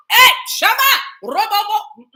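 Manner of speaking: very loud, high outburst; explosive cry of sacred syllables; spirit exclamation. Real, not acted. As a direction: shout it out, seized by the spirit. Sudden spirit exclamation mid talk.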